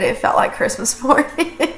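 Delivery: breathy, tearful laugh through the words; laughing through tears of gratitude; overwhelmed, half-crying delight; teary laugh. Real, not acted. Tearful after thanking donors.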